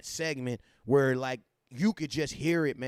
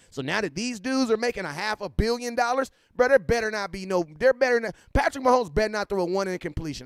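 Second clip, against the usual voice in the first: louder, higher rising rant about the quarterbacks; heated rant; 6 semitones higher, 6 dB louder than usual. Real, not acted. Streamer warns chat the quarterbacks better not miss, loud rising rant. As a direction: build it into a loud, indignant warning.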